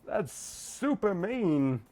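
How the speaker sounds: high playful whine; pouting girl character. Acted. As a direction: stretch "super mean" slowly in protest. Game character protesting teasing.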